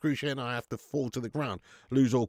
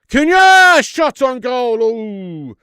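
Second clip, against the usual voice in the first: excited shout; 11 semitones higher, 18 dB louder, much slower than usual. Real, not acted. Reacting to a live football chance.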